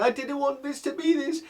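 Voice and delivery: high, wailing mimicry; mock a distraught mum about to burst into tears; mum near tears. Mum upset after son's rebuke, high distraught imitation. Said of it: "she burst into tears".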